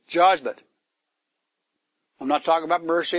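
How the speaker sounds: usual speaking voice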